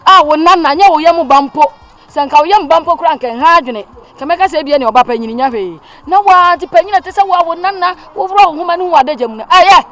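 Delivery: loud, high angry lecture; berating. Acted. Mother berating her daughter about marriage, loud and angry. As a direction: hammer the point home, loud.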